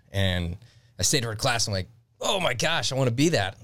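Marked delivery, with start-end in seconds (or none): 2.2-3.6 higher, fast eager outburst; excited kid. Retold or imitated. Teen telling his teacher he wants to be a seal, high and rushed. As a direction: gush like a kid who just found his dream. Boy hears about Navy seals.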